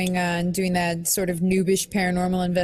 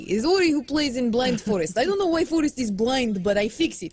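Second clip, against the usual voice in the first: put-on Russian accent, broken English; Russian-accented gamer intro. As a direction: play a Russian video host with a thick accent. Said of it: "It could either be Russian".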